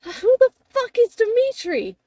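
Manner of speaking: high, loud outraged question; squawking indignation; indignant yell. Acted. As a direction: fire it back, pained and affronted. Hurt character snaps back at insult.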